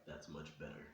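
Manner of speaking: low, hushed demon mother; demonic voice. Acted. Mother pleased her son gives in, mock-demonic. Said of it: "most demonic voice ever".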